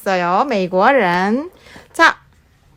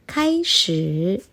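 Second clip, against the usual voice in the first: 느리게 끌며; 4 semitones lower, 6 dB quieter, much slower than usual. Acted. Slow hushed Chinese start call.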